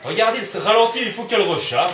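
high, loud protest at the game; loud exasperation. Real, not acted. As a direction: complain loudly mid-fight. Complaining loudly during gunfight.

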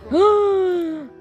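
high, long gasp; shocked gasp. Real, not acted. Player watching the family feud, high shocked gasp. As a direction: gasp sharply at the drama. Reacting to the characters' drama.

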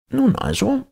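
high, exacting professor voice; pedantic professor. Professor quizzing his former pupil, high and impatient. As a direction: quiz him like a testy old schoolmaster.

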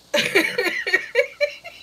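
high, breathless laughing fit; real uncontrollable laugh; helpless laughing. Real, not acted. Laughing about heels, unable to stop. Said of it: "I can't help myself now".

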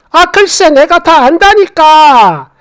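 exasperated insistence. Acted. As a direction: insist like an exasperated mother-in-law. 시어머니가 며느리에게 우기는 장면.

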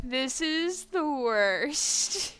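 slow, loud, stretched complaint; exaggerated drawn-out whine. Real, not acted. Streamer whining at game setback.

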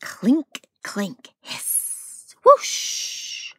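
loud clink then a hissed whoosh; lab noises. Acted. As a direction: voice the bubbling lab sounds. Lab sound effects voiced.

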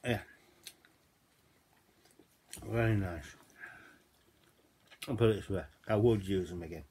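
talking while chewing bread; muffled through food; mouth full chewing. Real, not acted. Seen 4: Bites naan, then talks with puffed cheeks, chewing throughout.

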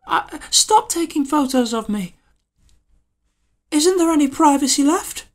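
mock high woman's voice from the film; woman's falsetto. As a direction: squeak it as the upset woman. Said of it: "goes something like".

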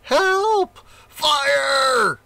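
very high, loud panicked cry; bellowing in fear. A narrator's reading. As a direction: scream for help as flames rise. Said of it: "he bellowed in fear".

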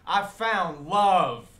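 loud, high, drawn-out proclamation; imitated street ranting. Cracked-out man walking at midnight, slow ecstatic shout. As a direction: holler it slowly into the air. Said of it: "cracked out, and he's like".

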